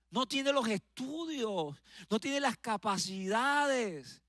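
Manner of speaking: high, slow, taunting put-on voice; the enemy's mocking discouragement, stretched out. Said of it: "La voz del enemigo".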